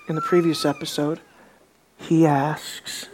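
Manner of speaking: ordinary talk, nothing marked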